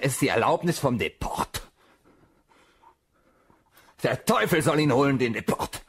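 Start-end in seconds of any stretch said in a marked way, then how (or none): 4.0-5.8 loud, higher furious curse; angry curse. Acted. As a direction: spit the curse at him. Flucht wütend über Deport. (wütender Fluch)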